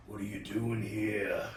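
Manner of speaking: slow, low, lightly voiced threat; a hint of a menacing veteran; mild menace while shaving; menacing question. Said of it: "and he goes".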